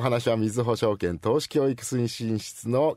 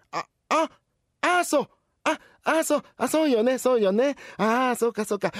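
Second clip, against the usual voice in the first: higher, excited "ah, I see"; sudden realization; 11 semitones higher than usual. Acted. Grandma suddenly understanding the cashier, excited. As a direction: let it dawn on her all at once.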